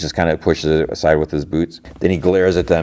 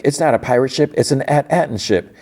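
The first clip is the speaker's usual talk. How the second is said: tag; 7 semitones higher than usual. Retold or imitated. Wim corrects, excited at discovery.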